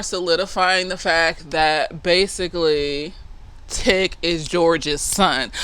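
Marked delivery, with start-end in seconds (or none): none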